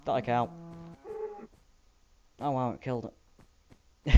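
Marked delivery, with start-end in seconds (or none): none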